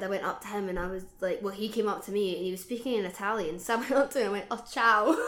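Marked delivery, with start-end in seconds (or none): 4.5-5.3 laughing. Retold or imitated. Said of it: "It was literally so bad".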